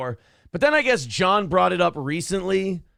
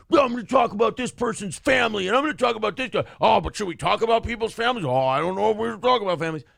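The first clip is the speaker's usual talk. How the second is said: hammed-up fussy worry, higher, quickening; mocking whiny hosts; 4 semitones higher, faster than usual. Podcaster mocking squabbling radio hosts, in a prissy mock voice. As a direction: sneer at the drama queens by parroting them.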